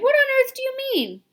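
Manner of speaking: shocked disbelief; startled cry. A narrator's reading. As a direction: insects crying out in surprise. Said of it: "they cried".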